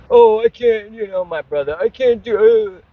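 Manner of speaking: high, whiny mock of the brother's excuses. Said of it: "Just giving me excuses why he can't do it".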